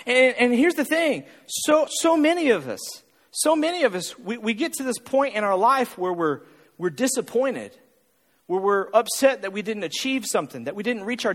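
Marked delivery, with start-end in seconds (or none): none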